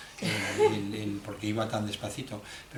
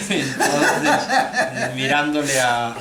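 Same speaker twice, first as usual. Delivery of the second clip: laughing; 3 semitones higher, 11 dB louder, much slower than usual. Real, not acted. Translator laughing at the punchline.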